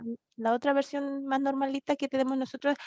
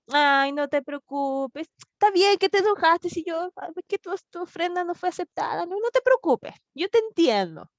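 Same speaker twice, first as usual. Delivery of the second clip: higher, sing-song pampering tone; mock coddling; 5 semitones higher than usual. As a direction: overdo the soothing, mockingly. Imagined God coddling Cain. (consuelo exagerado en burla)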